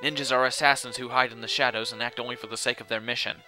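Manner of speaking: measured, level explanatory reading; lightly voiced solemn expert; grave know-it-all character. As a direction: explain it soberly, with little pitch movement. Voices game dialogue.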